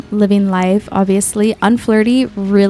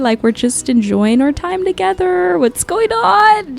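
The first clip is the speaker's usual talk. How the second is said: high, light mock girlfriend voice; the friends asking what's wrong, high and sing-song; friends' voice; 8 semitones higher than usual. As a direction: play the puzzled friends, pitched up. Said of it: "I'm sure they're like".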